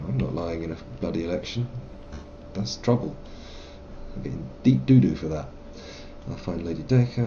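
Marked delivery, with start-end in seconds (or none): none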